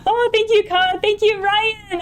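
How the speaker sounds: high, giddy gratitude; real joy, voice lifted high; happy elation. Real, not acted. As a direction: thank congratulators, still buzzing from the win. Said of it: "I'm so happy right now".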